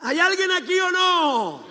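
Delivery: very high, drawn-out call; challenging shout. Real, not acted. Preacher checking that anyone is listening, challenging shout. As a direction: holler to wake up the congregation.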